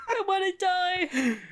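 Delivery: high, drawn-out wail through laughter; helpless laughter. Real, not acted. Friend dying at a crude joke, helplessly laughing. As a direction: crack up so hard the words stretch out.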